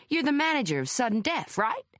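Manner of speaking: high-pitched American reporter; American journalist, voice lifted, rising tag question; American accent. A narrator's reading. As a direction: check eagerly in an American accent. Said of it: "Logan Royce had an American accent".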